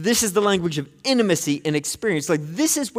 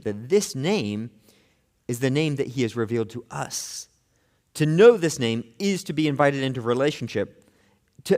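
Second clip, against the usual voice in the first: usual speaking voice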